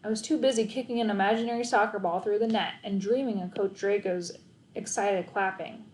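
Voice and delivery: usual speaking voice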